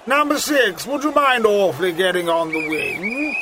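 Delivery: lightly plummy footballer, overly polite; a hint of posh gent on the pitch; posh English accent. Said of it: "took the pill ... normal accent".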